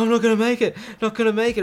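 retold panicky plea, pitched well up; mock desperate. Imitating mate Scott going down in the water, desperate. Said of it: "my mate Scott went down".

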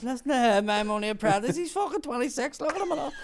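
mock the lad in front of her. Bumper mocking the apprentice to the customer: jeering high mockery.

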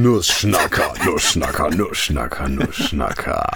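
tief und langsam, immer tiefer; tiefe Intro-Stimme. Acted. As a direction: wie ein dunkler Showansager, gedehnt. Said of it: "catchigeren Anfang haben". (tiefe, gedehnte Ansagerstimme)

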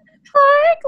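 whiny, babyish mock child, very high; exaggerated little-kid whine, pleading in a squeaky pitch; Caillou. Whiny cartoon kid begging his dad for the park, mocking whine. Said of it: "He's so whiny".

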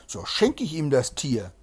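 plain reading voice

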